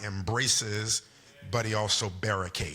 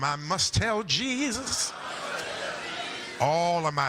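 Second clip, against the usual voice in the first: higher, deliberate declaiming with long pauses; preacher voice; 7 semitones higher than usual. Acted. Pastor modelling hymn lines for the congregation, preacher voice. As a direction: preach each hymn phrase out, stretching the key words.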